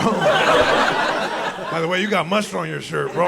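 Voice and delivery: slow, drawn-out smug jab; smug comeback. Acted. Comedian to fidgety guy in line, smug and slow. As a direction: savour every word of the burn.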